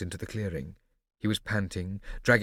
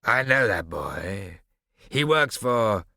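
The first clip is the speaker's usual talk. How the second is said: slow, loud, wary accusation; suspicious Barbarossa; 2 semitones higher, 6 dB louder, much slower than usual. A narrator's reading. Barbarossa suspicious of Prosper, slow and loud. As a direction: eye the boy and accuse him.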